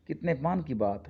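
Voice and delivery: ordinary delivery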